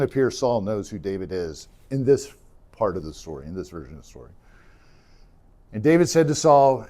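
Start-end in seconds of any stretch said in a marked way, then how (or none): none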